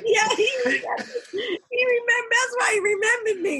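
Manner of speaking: high, laughing reply. Real, not acted. Answering the host about Frank's memory, laughing.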